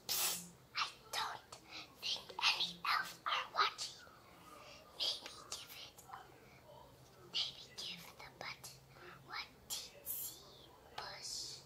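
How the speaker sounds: breathy secret whisper to a friend; conspiratorial whisper. Acted. Parent reading, whispering a sneaky suggestion to push.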